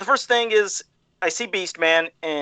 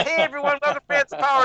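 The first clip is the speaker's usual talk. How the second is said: higher-pitched mock show intro; put-on high host greeting; imitating the host; 7 semitones higher, faster than usual. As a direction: mimic her greeting the audience in a raised voice. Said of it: "I love when she put her head down".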